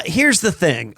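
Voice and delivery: usual speaking voice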